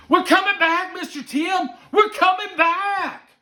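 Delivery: put-on very high, loud child yell; overexcited little boy. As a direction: burst in shouting like a thrilled kid. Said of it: "He didn't even let her finish".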